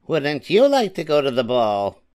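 high-pitched mocking stepsister; cruel teasing. A narrator's reading. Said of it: "teasing her cruelly".

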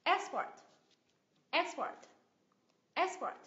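EX in "Export" high, then falling; first-syllable stress. Shown as a demonstration. Teacher models the noun Export, stressed on the first syllable. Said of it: "Nhấn âm tiết thứ nhất ạ".

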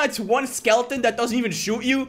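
loud, high-pitched ranting; shrieked questions, outraged at the game; incredulous outrage. Real, not acted. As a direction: yell in utter disbelief. Outraged that map is so small.

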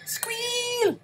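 very high piggy squeal. Pretend. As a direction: squeal it like a pig. Mimics the pig enemy's squeal.

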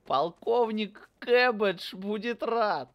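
mimicking game announcer. Mimicking game NPC line.

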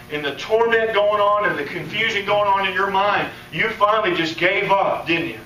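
preacher pressing the point, voice climbing; building sermon. Acted.

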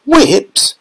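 high startled question; shocked cry. A narrator's reading. Veruca reading the storeroom door, shocked cry. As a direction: gasp out the word. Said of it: "cried Veruca Salt".

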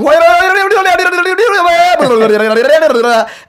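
high, loud rolling nonsense syllables; imitating an uncontrolled vocal tic; loud babbling outburst. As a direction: let out a long tongue-rolling monkey jabber. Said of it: "so I'm out loud going".

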